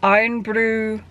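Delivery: unconvincing Scottish accent; attempted Scottish accent. Shown as a demonstration. Trying out a Scottish accent on a drink's name, attempted Scottish accent. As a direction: have a tentative go at a Scottish accent. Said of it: "let me just try the Scottish accent".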